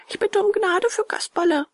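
high-pitched humble plea; pleading puppet boy. A narrator's reading. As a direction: beg for mercy in a high voice. Puppet begs for his friend. (hohe, bittende Puppenstimme)

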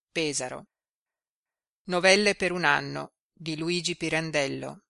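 usual speaking voice

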